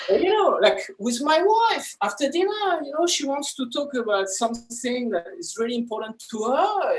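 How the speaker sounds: normal talking voice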